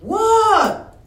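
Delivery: high, loud disbelieving what; mock outraged demand; hammed-up shocked pastor; incredulous what. Member acts out pastor's outburst.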